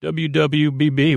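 ordinary delivery

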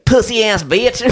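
very high, exaggerated American caricature; mock American accent. Coworker imitating Americans, high exaggerated American accent. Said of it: "when Americans say".

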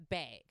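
"bag" with the Wisconsin A, like "beg". Shown as a demonstration. Demonstrating the A thing, "bag" said like "bayg". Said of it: "it is a thing with our A's".

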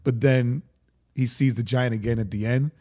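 normal talking voice

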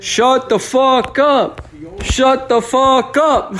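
high, stretched-out retold yell; yelling back. Yelling back at noisy roommate, retold high yell. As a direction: re-enact hollering down a hall, each word drawn out. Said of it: "yelling back at him".

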